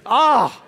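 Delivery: loud, high "ah" of relish; hammed-up connoisseur's "ah"; exaggerated delighted sigh. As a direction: savor it with a big mock-blissful "ah". Savoring a pleasant nerdy word.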